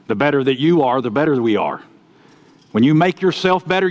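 usual speaking voice

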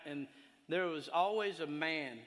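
usual speaking voice

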